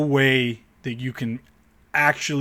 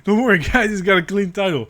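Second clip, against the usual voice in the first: higher, louder sarcastic Don't worry, guys; sarcastic higher reassurance to viewers; mock reassurance; 7 semitones higher, 5 dB louder than usual. Real, not acted. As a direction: fake reassurance, dripping sarcasm. Repeats it mockingly.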